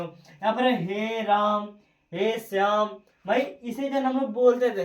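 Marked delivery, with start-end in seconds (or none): 0.8-2.9 हे राम, हे श्याम with stretched vowels; prolonged vowels. Shown as a demonstration. Teacher giving examples of prolonged vowels, हे राम, हे श्याम drawn out. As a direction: hold each word long, हे राम, हे श्याम. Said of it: "इस type से बोलते रहते हैं".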